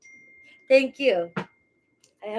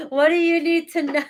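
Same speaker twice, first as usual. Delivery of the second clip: high, loud teasing voice; playful put-on voice; 4 semitones higher, 5 dB louder than usual. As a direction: tease the viewers in a silly voice.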